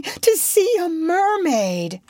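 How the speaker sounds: high, slow, drawn-out wonder; dreamy girl longing to see it; crying out in longing. A narrator's reading. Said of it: "cried Wendy".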